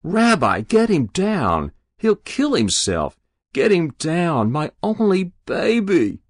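high, wailing plea; frantic mother. A narrator's reading. Mother to the kneeling rabbi, frantic pleading. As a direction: beg for help for your child.

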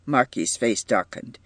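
usual speaking voice

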